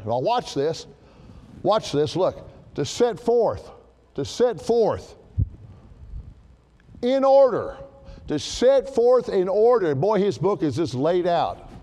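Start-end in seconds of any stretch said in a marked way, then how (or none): 2.7-9.9 preacher hammering the phrase; emphasis on set forth in order. Shown as a demonstration. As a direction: stress "set forth in order" each time, building. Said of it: "read it with some emphasis".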